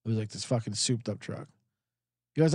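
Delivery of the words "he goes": usual speaking voice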